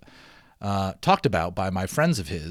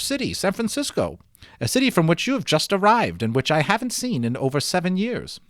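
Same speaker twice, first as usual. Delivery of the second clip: higher, lightly voiced older islander, friendly; a lightly voiced, higher Mister F asking eagerly for news; 7 semitones higher than usual. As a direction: lift the voice up for Mister F, curious and eager. Mister F speaks at length.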